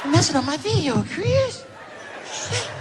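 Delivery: high, soft-voiced scolding impression. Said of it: "[name] was getting mad".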